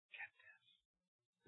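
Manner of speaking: a hushed, confiding whisper; secret-sharing whisper. Real, not acted. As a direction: lean in and whisper get this. Preacher drops to whisper.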